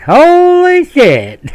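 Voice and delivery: high, loud, drawn-out holy shit; amazed. Retold or imitated. Sergeant learning the soldier's rank, slow high amazement. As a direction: stretch it out in slow astonishment.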